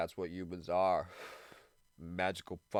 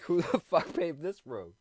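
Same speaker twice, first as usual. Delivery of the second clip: higher, mock-indignant complaint; exasperated; 7 semitones higher than usual. Driver griping at a pothole-filled road, mock outrage. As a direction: gripe at whoever paved it. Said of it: "It's like".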